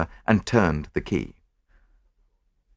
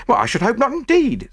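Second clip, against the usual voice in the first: high, self-righteous indignation; virtuous; 4 dB louder than usual. A narrator's reading. Chambermaid cleared by the search, virtuous. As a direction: sound loftily innocent, pitched high. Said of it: "said the chambermaid virtuously".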